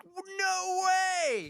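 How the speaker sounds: high-pitched mock amazement; amazed exclamation. Parent finding toddler using the toilet, high amazed cry. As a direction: exclaim it way up high, in delighted disbelief.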